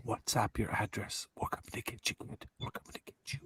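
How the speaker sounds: put-on whispered menace to a kid; creepy whisper. Host whispering a spooky threat to the kid. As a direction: breathe the threat like a stalker.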